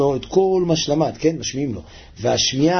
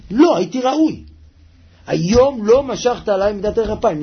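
higher-pitched protest to God; impassioned plea; 6 semitones higher, 3 dB louder than usual. Acted. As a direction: plead your case before God. Acted personal plea to God.

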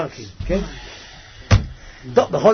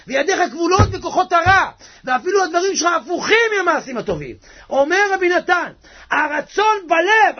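fervent preaching; 11 semitones higher, 5 dB louder than usual. Real, not acted. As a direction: preach each phrase with full force. Preaches at full force.

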